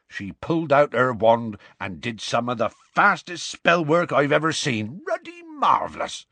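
excited rustic storyteller, pitch swooping up; Hagrid, admiring. Acted. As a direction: relive the escape with relish. Hagrid admires a spellcaster, recounts escape.

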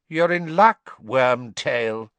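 high, loud, cold master; cold, high-pitched voice. A narrator's reading. Cold master to cowering servant, high-pitched and cold. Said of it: "said a cold, high-pitched voice".

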